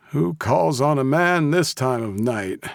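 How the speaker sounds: high, slow grumble of disgust. A narrator's reading. Old man grumbling at an unexpected night visitor, disgusted. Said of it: "he asked in disgust".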